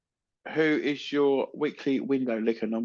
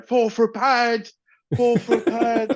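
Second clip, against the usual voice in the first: slow, raised mock Chinese accent; a hint of a Chinese accent, slow and higher; imitating Chinese advert accent; 7 semitones higher, 3 dB louder, slower than usual. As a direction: ham up the advert bloke's accent, slowly. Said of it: "Chinese bloke ... he's going".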